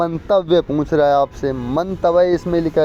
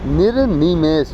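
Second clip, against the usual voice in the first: निर्निमेष said slowly, each syllable clear; slow careful spelling; slower than usual. Shown as a demonstration. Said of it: "यहाँ पर देखो". (निर्निमेष धीरे-धीरे, हर अक्षर साफ़)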